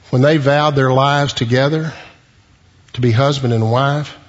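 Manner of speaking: ordinary delivery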